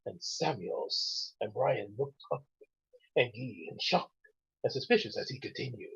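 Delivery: soft, near-whispered storytelling; hushed story reading. Acted. Host reading a fan story, hushed.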